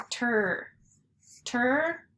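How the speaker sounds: "ter" as T and R joined; isolated TR sound, "ter", for learners; blended consonants. Shown as a demonstration. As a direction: say "ter" as a separate blend, then repeat it. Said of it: "so together they say".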